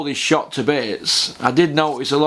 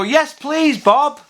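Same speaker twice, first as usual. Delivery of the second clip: chirpy mock contestant, much higher; eager contestant; 12 semitones higher, much slower than usual. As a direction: beam it at the host. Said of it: "they'd go".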